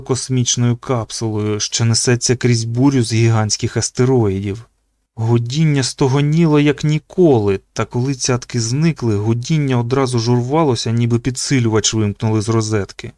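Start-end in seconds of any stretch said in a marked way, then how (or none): none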